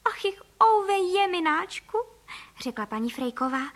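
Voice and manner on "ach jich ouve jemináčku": high, slow wail of dismay; storybook oh-dear lament; woeful lament. A narrator's reading. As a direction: moan it out high and drawn-out. Mother bird laments lost house.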